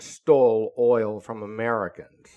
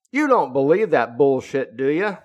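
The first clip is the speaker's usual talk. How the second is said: louder, higher mocking scoff at the idea; incredulous, raised-voice challenge; scoffing disbelief; 4 semitones higher, 9 dB louder than usual. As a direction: scoff like he can't believe anyone buys it. Rick scoffing at the speaker.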